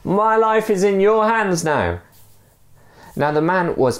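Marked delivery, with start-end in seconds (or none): 0.0-2.0 raised-pitch mock call; a raised plea from the man below; storytelling shout. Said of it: "shouted the other man".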